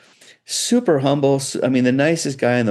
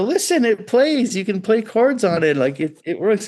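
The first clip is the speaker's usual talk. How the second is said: defending my guitar; 10 semitones higher than usual. Retold or imitated. Young builder insisting to the shop owner, earnestly pleading. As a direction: make the case it really works. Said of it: "I go".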